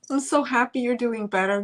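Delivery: usual speaking voice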